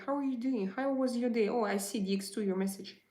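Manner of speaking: usual speaking voice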